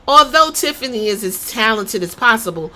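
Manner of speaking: ordinary talk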